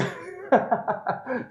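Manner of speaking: high bursting laugh; burst of laughter. Real, not acted. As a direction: crack up laughing. Laughs at the viewer's name.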